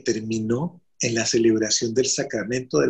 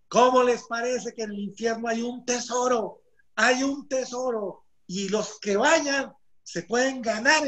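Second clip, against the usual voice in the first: high-pitched, drawn-out proclamation; vehement; 10 semitones higher than usual. Retold or imitated. Paisa touting hell's treasure to all of heaven, vehement. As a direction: sell the treasure with mounting fervour, pitched high. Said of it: "él contaba esto vehementemente".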